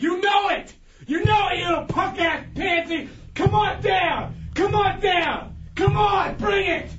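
high-pitched shouting rant; ranting and raving. Real, not acted. Shouting at absent Mad Max to come down, raving. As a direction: scream him out to fight. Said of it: "All that ranting and raving".

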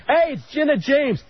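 high-pitched announcing call; mock yelling. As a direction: re-enact hollering out a big name. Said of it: "you're just yelling".

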